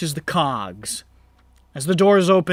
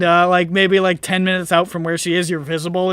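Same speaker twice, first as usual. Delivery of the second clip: normal talking voice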